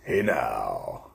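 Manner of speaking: low drawn-out catchphrase; Howard Stern impression. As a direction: imitate a radio host in a deep put-on voice. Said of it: "Howard Stern goes".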